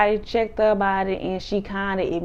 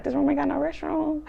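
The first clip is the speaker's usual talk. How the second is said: high-pitched incredulous mimicry; mock surprise; 8 semitones higher than usual. Hostess told about the restroom, mock surprise. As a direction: imitate someone surprised, pitched up.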